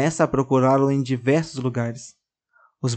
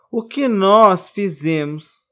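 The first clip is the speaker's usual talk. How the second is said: distressed; 5 semitones higher, 5 dB louder, much slower than usual. A narrator's reading.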